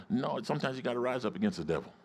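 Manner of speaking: normal talking voice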